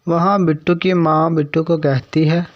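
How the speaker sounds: ordinary delivery, nothing marked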